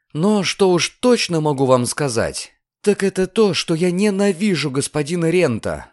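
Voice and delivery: high, emphatic announcement; girl declaring her hatred; defiant declaration. A narrator's reading. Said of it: "объявила она".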